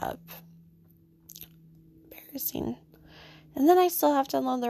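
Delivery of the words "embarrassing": breathy aside under the breath; embarrassed whisper. Real, not acted. Telling listeners about getting up from a chair, whispered in embarrassment. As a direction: whisper it to yourself, mortified. Muttered self-judgement after clumsy chair.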